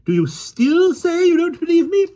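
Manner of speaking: very high, loud challenging question; triumphant lady. A narrator's reading. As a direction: crow it at him, rising high. Miss Lark triumphant challenge.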